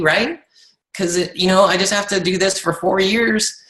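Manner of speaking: high-pitched, imagined enthusiastic reply; acting out an easy, excited yes; eager agreement. Acted eager reply to guaranteed income offer.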